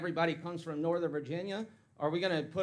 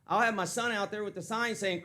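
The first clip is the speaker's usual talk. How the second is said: normal talking voice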